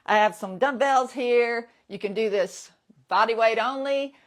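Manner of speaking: normal talking voice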